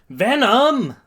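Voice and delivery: high, loud exasperated shout; angry yell. Acted. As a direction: yell the name in anger. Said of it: "Midoriya angrily yells".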